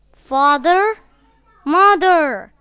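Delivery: put-on high, loud frightened child's call; lost boy calling loudly for his parents; crying aloud. A narrator's reading. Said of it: "he cried aloud".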